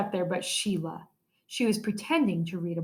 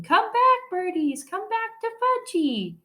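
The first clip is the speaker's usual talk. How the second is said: put-on high toddler scream, slowing at the end; 12 semitones higher, slower than usual. A narrator's reading. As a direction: shriek after the birds like a small child. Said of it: "was screaming".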